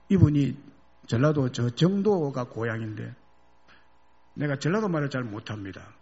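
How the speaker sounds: normal talking voice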